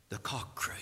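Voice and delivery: whispered, dropping to almost nothing; hushed whisper. Acted. Preacher hushing the congregation at Peter's fall, whispered. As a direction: breathe it like a grave secret.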